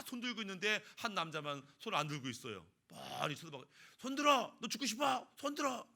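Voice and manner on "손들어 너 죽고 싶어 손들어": barked threat, pitched higher; a bank robber hammed up, yelling at a customer; mock gunpoint yell; robber's threat.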